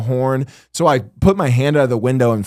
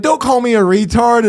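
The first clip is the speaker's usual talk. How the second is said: higher and a touch louder, imitating her outrage; mock yelling woman; 10 semitones higher, 4 dB louder than usual. Driver imitating the offended woman, higher-pitched mock yell. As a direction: play up her indignant shout. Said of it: "she started yelling at me".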